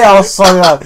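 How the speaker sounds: loud mocking impression of Mike; hammed-up sorry lad voice. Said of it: "I didn't say".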